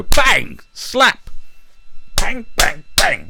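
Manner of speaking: playful hammed-up shouting; mock fight noises. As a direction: punch each bang out like a cartoon brawl. Acting out hitting a friend.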